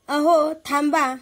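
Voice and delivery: loud, slow plea to stop; mother pleading loudly with father to stop; alarmed cry. Acted. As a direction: call out to stop the husband. Mother stops father hitting son.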